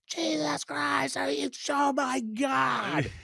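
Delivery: very high, drawn-out exasperated scream; screaming at phone. Retold or imitated. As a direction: scream it in mock outrage, stretching the words. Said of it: "was screaming at the, my phone".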